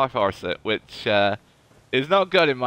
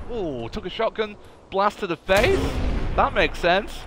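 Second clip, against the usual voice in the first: ordinary delivery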